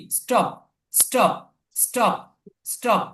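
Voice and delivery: স্টপ with ট, no puff of breath; unaspirated T. Shown as a demonstration. Teacher corrects the reading for students, স্টপ with ট sound. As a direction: say স্টপ with a plain retroflex T. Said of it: "ট এর মতো উচ্চারণ হবে".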